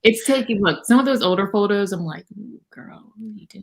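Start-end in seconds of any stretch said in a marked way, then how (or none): 2.4-3.6 breathy whispered tease at herself; mock whisper. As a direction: whisper it like an embarrassed aside. Cringing at her old photos.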